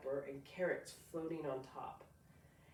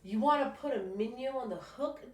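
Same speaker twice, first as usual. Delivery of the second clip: higher, louder kindly dad offering bait; a lightly voiced dad, higher and louder; dad's voice; 7 semitones higher, 8 dB louder than usual. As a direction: voice the father asking gently.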